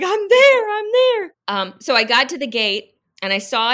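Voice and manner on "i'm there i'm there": high, loud, breathless cry of triumph; excited cry. Retold or imitated. Runner nearing the gate shouts her joy, high and loud. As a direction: shout it like the finish is right there.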